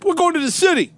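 high, loud mock Long Island yell; hammed-up angry outburst; mad Long Islander yelling. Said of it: "everyone's yells at you".

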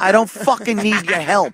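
high-pitched offended rebuff; mock indignant customer. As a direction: refuse the help angrily, playing it up. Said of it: "He should turn to that idiot and just go".

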